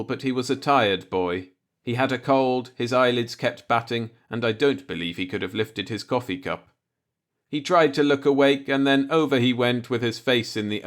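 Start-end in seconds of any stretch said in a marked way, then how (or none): none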